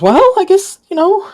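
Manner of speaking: high, loud nervous voice; nervous shopkeeper. Acted. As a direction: rush it out, rattled. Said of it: "he goes".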